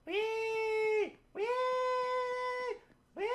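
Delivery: high, loud playful wee; gleeful squeal. Pretend. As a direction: squeal like a kid on a spin ride. Playful squealing while spinning around.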